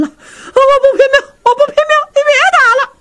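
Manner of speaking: very high, shrill panicked pleading; desperate begging. Acted. Beaten wife Feng giving in to her husband, shrill panicked begging. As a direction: cry out surrendering in pure panic.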